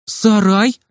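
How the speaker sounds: high rising echo question; surprised. A narrator's reading. Nurse echoes the boy's demand, surprised. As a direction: repeat it in astonishment. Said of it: "Медсестра так удивилась".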